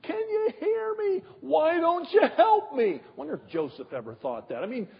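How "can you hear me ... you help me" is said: high, whining plea to God; mock-whine it like a frustrated believer; hammed-up high complaint to God; whiny prayer. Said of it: "Sometimes we say".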